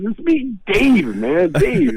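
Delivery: high put-on voice insisting on his name. [name] knocking, finally giving a name: high whiny insistence. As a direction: whine the name up high like a stoned friend. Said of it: "They finally goes".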